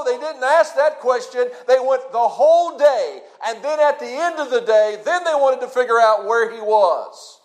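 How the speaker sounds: ordinary talk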